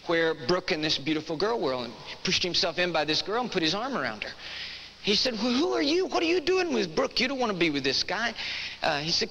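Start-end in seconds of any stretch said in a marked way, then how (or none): 5.3-8.3 high, pushy questioning of a stranger; retold brash come-on, pitched up; brash drunken challenge. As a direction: imitate a rowdy friend butting in. Drunk man accosts a girl.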